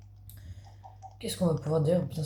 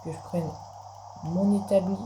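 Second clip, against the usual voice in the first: usual speaking voice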